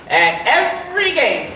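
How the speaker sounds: ordinary talk, nothing marked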